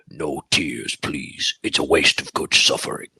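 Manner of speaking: very low, sinister horror-villain voice; Pinhead. Retold or imitated. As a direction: intone it deep like a movie demon. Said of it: "like Pinhead".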